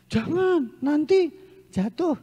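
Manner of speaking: put-on high, fretful mother's warning to her child; anxious mother. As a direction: imitate a fussy mother crying out "don't". Worried mother warns jumping child.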